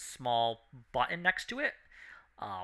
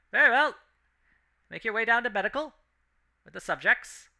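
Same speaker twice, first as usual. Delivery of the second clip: loud, high voice over a speaker; harsh intercom voice; 9 semitones higher, 4 dB louder, faster than usual. Acted. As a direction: bark it through the grill.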